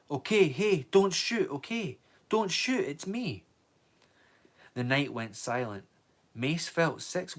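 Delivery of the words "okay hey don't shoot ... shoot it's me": high, loud frightened call. Acted. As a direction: shout into the dark so they don't shoot. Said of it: "in a loud call".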